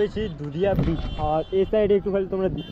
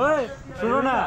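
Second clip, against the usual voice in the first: high coaxing call; yelling across the room; 9 semitones higher than usual. Real, not acted. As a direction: call him over, pleading. Calling friend across room.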